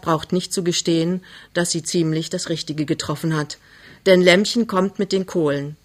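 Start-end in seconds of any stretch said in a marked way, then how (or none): none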